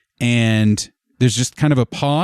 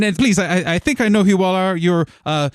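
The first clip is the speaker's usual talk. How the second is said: high, flustered, rapid-fire hospitality; excited welcome; 8 semitones higher, faster than usual. Acted. Administrator trying to organize everything while welcoming the guild, flustered excitement. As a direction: trip over yourself welcoming them, much higher pitched. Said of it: "while excitedly welcoming".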